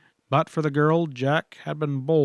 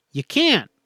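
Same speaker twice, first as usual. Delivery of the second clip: a sharp, higher forceful "can't"; ground out; 8 semitones higher, 3 dB louder than usual. A narrator's reading. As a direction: cut her off hard on "can't". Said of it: "he ground out".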